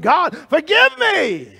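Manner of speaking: high, loud, slow plea; crying out to God. Acted. Preacher voicing Jonah crying out for forgiveness. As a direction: cry it out like a desperate man.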